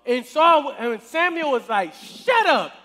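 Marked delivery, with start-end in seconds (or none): 1.9-2.7 high, loud cut-off; sharp rebuke. Acted. Samuel silences Saul's boasting, loud sharp rebuke.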